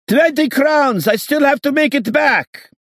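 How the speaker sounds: very high, loud shriek; shrill haggling yell; falsetto shouting. A narrator's reading. Haggling drivers shouting in blizzard.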